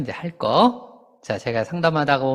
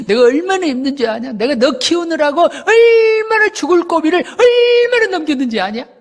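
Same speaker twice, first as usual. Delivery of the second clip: higher, louder, slowed-down scolding rant; aggrieved parent; 12 semitones higher, 5 dB louder than usual. Retold or imitated. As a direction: lay the guilt on the child, pitch climbing. Voicing a complaining elder parent.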